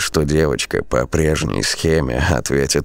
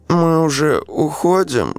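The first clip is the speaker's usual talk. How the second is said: put-on high drowsy child voice; sleepy child. A narrator's reading. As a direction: mumble like a half-woken little girl. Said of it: "сонно пробормотала она".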